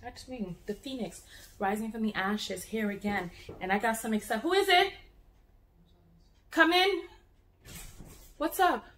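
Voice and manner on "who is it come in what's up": higher and louder, calling to the door. Real, not acted. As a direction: call out to someone outside the room.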